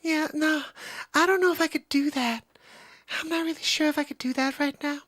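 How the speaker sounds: high, delicate pop-star voice. Acted. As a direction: speak in a sensitive, lifted head voice. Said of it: "[name]'s voice".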